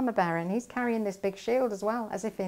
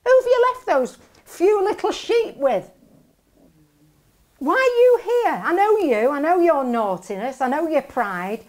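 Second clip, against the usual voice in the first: higher, louder scolding that cools at the end; angry big brother; 5 semitones higher, 6 dB louder than usual. Retold or imitated. Eldest brother telling David off, angry and higher. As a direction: scold the little brother, hot then settling. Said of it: "is so angry with David".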